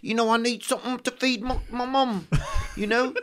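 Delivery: high pleading Cockney beggar voice; Cockney street urchin. Retold or imitated. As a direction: whine like a poor London street kid. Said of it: "if you're like that".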